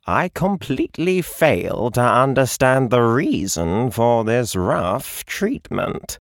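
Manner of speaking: a slow, offended feline drawl; talking cat. Acted. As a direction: drag it out like an affronted cat. Cat speaks from mirror perch.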